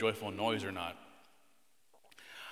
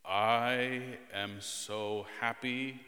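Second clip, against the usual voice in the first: very slow, drawn-out, joyless delivery; sarcastic monotone; 3 semitones lower, much slower than usual. Shown as a demonstration. Dad asking children to compare tones, sarcastic drone. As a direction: drag out each word with no enthusiasm at all. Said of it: "One sounds sarcastic".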